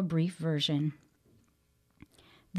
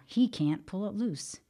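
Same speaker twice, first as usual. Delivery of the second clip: ordinary talk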